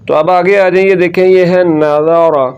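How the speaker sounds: ordinary talk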